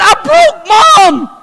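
put-on high, loud childish wail; a kid sure he broke his mom; high crying child's voice; distraught little boy. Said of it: "I went".

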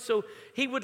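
normal talking voice

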